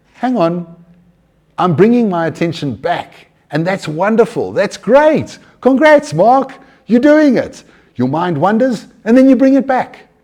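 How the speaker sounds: high, loud self-congratulation, building; encouraging self-talk. Acted. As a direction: cheer yourself on, climbing to a celebratory peak.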